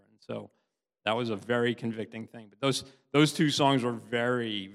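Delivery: ordinary talk, nothing marked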